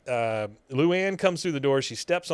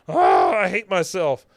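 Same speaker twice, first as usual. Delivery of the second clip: dismayed wail; 6 semitones higher, 8 dB louder than usual. Retold or imitated. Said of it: "she goes".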